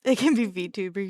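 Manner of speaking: ordinary talk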